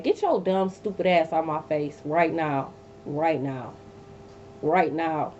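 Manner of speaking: slow, low and cold: get out my face; a quiet threat, each word dragged out; low menacing telling-off. Retold or imitated. To the ex at her door, a slow, low, menacing order.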